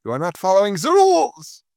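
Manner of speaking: put-on stern German accent. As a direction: bark it in a harsh German accent. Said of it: "I sounded like a German".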